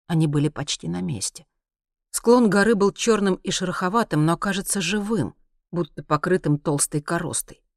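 ordinary delivery, nothing marked

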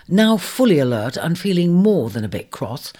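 ordinary delivery, nothing marked

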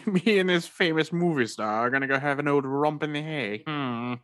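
high, smug English roommate voice; Hugh Grant impression. Roommate boasting about a celebrity, in a high smug English voice. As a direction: purr it like a smug posh Englishman. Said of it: "my impression of Hugh Grant".

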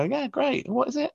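very high acted enthusiasm; high-pitched obliging reply; keen interest. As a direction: lift the voice right up, playing interested. Acts out interested staff reply.

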